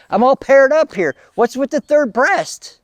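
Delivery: acted-out indignant outburst; exasperated protest. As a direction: build to a baffled, high complaint. Lilith protests the third breast.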